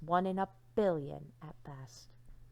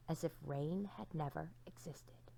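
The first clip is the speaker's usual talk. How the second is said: hushed narration; 3 semitones lower, 10 dB quieter than usual. A narrator's reading.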